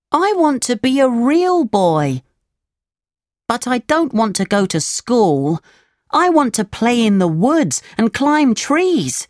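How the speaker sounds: put-on high, childlike puppet voice; high-pitched storybook puppet; protesting puppet. A narrator's reading. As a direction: complain in a high little voice. Voices wilful puppet Pinocchio.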